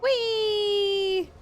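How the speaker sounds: high, loud joyful squeal; gleeful whee. Real, not acted. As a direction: squeal it like a ride. Gleeful cry while flung.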